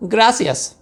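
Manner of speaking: high little dog voice; Scapito voiced. A narrator's reading. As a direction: chirp it like a tiny Chihuahua. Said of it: "said Scapito".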